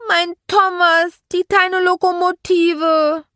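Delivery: high, loud, drawn-out wail; toddler bawling. A narrator's reading. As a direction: bawl it like a three-year-old. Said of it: "jetzt heulte Archie noch lauter".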